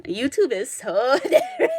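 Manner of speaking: laughing through the words. Real, not acted. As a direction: break into a laugh mid-line. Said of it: "repeats line, laughing".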